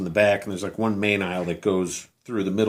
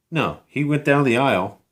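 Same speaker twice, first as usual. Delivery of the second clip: ordinary talk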